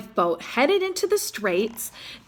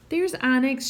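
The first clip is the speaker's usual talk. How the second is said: ordinary delivery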